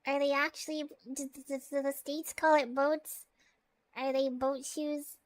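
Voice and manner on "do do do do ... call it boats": usual speaking voice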